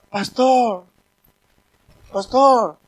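imitating a timid humble voice; a light put-on meek whine; lightly voiced humble man calling pastor; meek changed voice. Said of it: "le cambió la voz".

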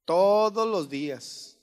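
Todos los días spelled out slowly, weight on todos; drawn out so it sinks in; slow emphasis. Shown as a demonstration. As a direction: hammer home every single day. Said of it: "Necesitas reafirmarle el amor todos los días".

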